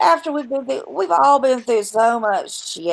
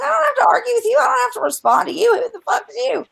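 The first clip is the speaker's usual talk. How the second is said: high, incredulous brush-off; mock outrage at her, pitched up; dismissive high retort to the accuser; indignant retort; 10 semitones higher, faster than usual. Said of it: "I'm like".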